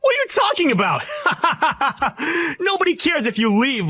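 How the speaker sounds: slow, high scornful laughter; mocking laugh. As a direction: laugh off his threat with contempt. Groom mocking laugh at threat.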